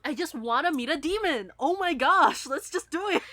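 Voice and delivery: high, loud, eager gush; excited teen. Retold or imitated. Cohost voicing Trainee eager to summon, high excited gush. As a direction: bubble over wanting to meet a demon. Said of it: "It was just like".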